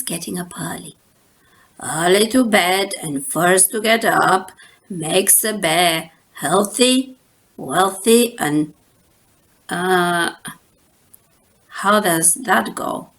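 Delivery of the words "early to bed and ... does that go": lightly voiced papa. Papa reciting, forgets proverb.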